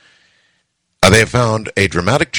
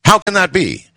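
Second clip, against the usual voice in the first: high, mildly indignant protest; outraged accusation; 10 semitones higher than usual. A narrator's reading. Husband accuses wife over breeding lizards, mildly outraged. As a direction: accuse with mock outrage, pitch raised. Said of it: "I was equally outraged".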